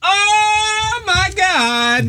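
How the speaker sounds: high, amazed oh my God; gasping at the new cruise hats; surprised gasp. Real, not acted. Host seeing the merch hats, high amazed exclamation.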